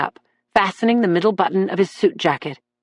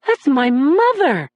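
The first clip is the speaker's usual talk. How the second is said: wonder; 7 semitones higher, slower than usual. A narrator's reading. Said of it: "said with wonder in his voice".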